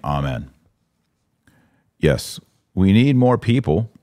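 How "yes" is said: normal talking voice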